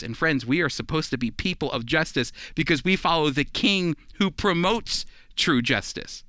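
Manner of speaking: normal talking voice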